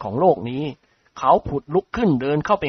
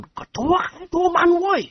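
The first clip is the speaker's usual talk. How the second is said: put-on high, slow old man's blurt; an elderly nobleman blurts every man for himself. A narrator's reading. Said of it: "พูดโพล่งขึ้น". (เจ้าคุณพูดโพล่งเสียงสูง)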